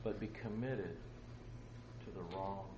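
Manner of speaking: soft, halting, with long pauses; hushed solemn appeal. Real, not acted. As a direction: drop the volume and let each question sink in. Solemn closing appeal to listeners.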